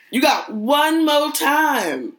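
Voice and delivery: high, slow, drawn-out grandma warning; angry grandma voice. Acted. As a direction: give one last warning, grandma-style.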